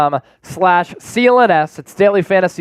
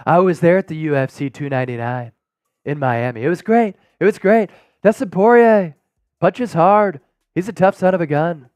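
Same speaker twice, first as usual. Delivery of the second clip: Donald Trump voice; 4 semitones lower than usual. Retold or imitated. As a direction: lower your voice and brag like the former president. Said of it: "That's my Donald Trump impersonation".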